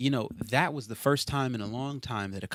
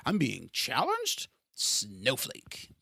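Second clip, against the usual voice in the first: mocking voice; 2 semitones higher, much slower than usual. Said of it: "You're sensitive about things".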